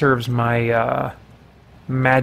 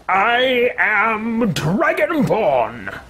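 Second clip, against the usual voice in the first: grand hero voice; 8 semitones higher, much slower than usual. Pretend. Player answers in character.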